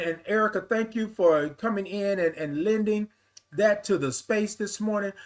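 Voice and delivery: ordinary delivery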